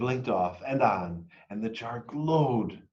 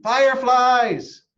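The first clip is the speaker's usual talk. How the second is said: much higher, loud, stretched call; 14 semitones higher, 10 dB louder, slower than usual. A narrator's reading. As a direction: call it across the street, long and loud. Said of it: "I called to my friends".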